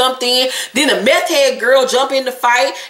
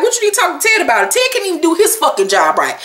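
higher, hurried retold objection; exasperated quote, pitched up; mock exasperation; 7 semitones higher, faster than usual. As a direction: imitate an impatient pushback. Retells Sky objecting to C's.

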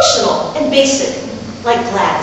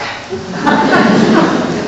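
light laugh; 7 semitones higher than usual. Real, not acted. Laughing at own story.

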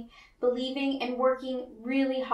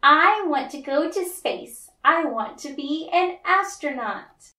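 put-on high, louder child voice, proud answer; eager little girl; 4 semitones higher, 5 dB louder than usual. May tells Miss Bell, high and proud. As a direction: announce your dream to the whole class.

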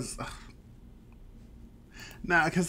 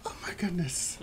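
breathless whisper, softer; 7 dB quieter than usual. Real, not acted. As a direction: whisper it, overwhelmed. Reacting after laughing.

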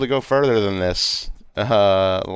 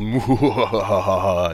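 drawn-out villainous "mwahaha"; evil laugh. Shown as a demonstration. Recalling the Halloween Let's Play opening with a hammed-up evil laugh. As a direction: put on a theatrical evil laugh. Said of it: "have my whole evil".

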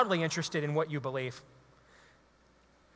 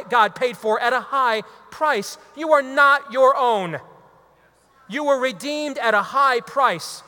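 slow, weighty sermon delivery; slow pulpit emphasis; emphatic preaching; 2 semitones higher, slower than usual. Acted. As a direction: lay down each word like a verdict. Preaching slowly and forcefully.

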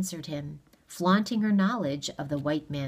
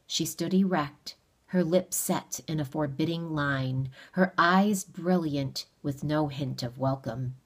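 ordinary delivery, nothing marked

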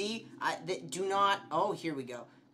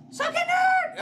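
very high, loud shriek; screamed taunt; 10 dB louder than usual. Real, not acted. Player taunts game monster, screaming. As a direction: scream the jeer at full pitch.

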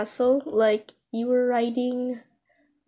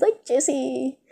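ordinary talk

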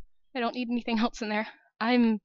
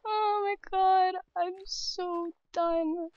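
exhausted, drawn out; 6 semitones higher, much slower than usual. Real, not acted. Exhausted after scare.

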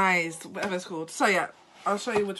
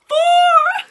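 real burst of joy, much higher and louder; excited shriek; 13 dB louder than usual. Real, not acted. Counting the gift films from her friend, a real excited shriek. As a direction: shriek the number out in delight. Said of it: "I'm so excited".

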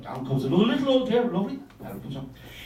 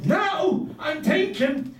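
ordinary delivery

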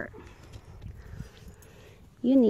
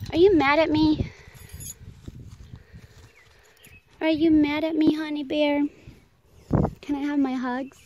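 higher, coaxing pet voice; 6 semitones higher, 4 dB louder than usual. Real, not acted. Owner sweet-talking her horse for hugs, higher pitch. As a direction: sweet-talk the sulky horse.

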